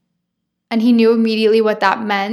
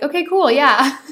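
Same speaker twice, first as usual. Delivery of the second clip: casual cool; 4 semitones higher, 3 dB louder than usual. Retold or imitated. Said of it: "she was very much like".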